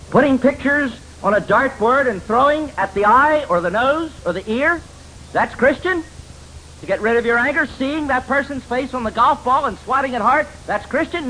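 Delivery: high, incredulous rhetorical scorn; high-pitched mock outrage; mocking disbelief. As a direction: sneer at the idea as absurd.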